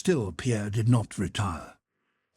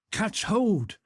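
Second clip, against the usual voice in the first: usual speaking voice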